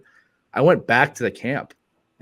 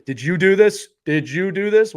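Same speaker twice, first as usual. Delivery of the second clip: accusing parent; 7 semitones higher, 5 dB louder than usual. Retold or imitated. Parents questioning their kids.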